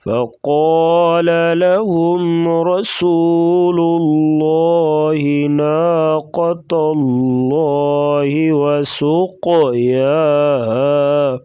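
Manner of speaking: slow melodic tajweed chant; Quran recitation. Shown as a demonstration. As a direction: recite the verse flowing. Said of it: "recites full verse after rules".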